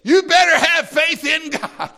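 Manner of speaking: "You better have faith in God" thrown out high and loud; a high, loud challenge to the listeners; commanding challenge. Shown as a demonstration. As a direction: throw it down as a demand. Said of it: "I'm throwing down a challenge, I'm giving you a command".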